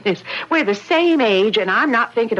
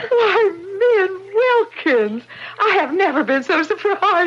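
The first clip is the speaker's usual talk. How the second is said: surprised delight; 9 semitones higher than usual. Acted. Laura greets Min at the door, high and amazed. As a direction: greet her in happy astonishment. Old friend appears at the door.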